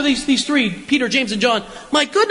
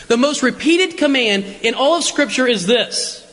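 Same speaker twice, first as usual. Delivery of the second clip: usual speaking voice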